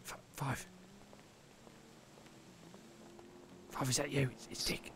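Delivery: unvoiced hushed whisper. Detective secretly reveals himself to partner, in a whisper. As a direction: whisper it so only Five hears.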